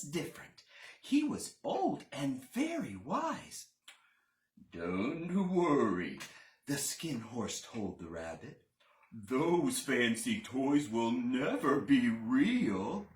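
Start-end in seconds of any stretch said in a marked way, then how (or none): 4.7-6.2 put-on slow, low old horse voice; calm and wise, low and slow. Skin horse speaks, old wise. 9.1-13.1 put-on slow, low old horse voice; calm and wise, low and slow. Skin horse speaks.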